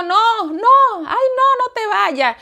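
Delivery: high pleading cry; high desperate begging. Retold or imitated. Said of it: "Y yo".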